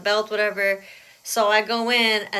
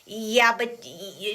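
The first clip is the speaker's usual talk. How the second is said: stuttering; much slower than usual. Retold or imitated. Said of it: "she kept, like, stuttering".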